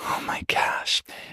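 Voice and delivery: breathless whispered oh my gosh; real hushed disbelief; awed whisper. Real, not acted. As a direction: barely voice it, stunned. Listener stunned by the story.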